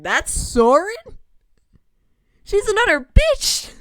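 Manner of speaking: shocked, then explode at the reveal; outraged disbelief. Real, not acted. Gamer discovering who Soren is, outraged shout.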